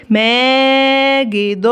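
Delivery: ماجد drawn out slowly, every sound held; syllable by syllable. Shown as a demonstration. Teacher modelling the word for pupils, slowly stretched. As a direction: stretch ماجد so the children hear the long ا. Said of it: "زي كلمة إيه؟".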